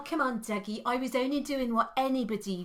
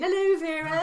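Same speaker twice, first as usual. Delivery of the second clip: cheery greeting; 7 semitones higher, 4 dB louder than usual. Acted. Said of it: "you just have everyone saying".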